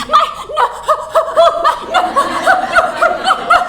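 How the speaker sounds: very high, loud sobbed refusals; child's wailing protest. Acted. Daughter crying over her pinched nose, wailing protest. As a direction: wail like a child who is hurt.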